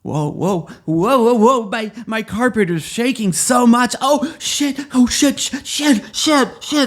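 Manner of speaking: high, loud panicked yelps building up; hammed-up terror as the carpet shakes; mock panic. Aladdin panicking as his carpet shakes over the playground, high loud yelps.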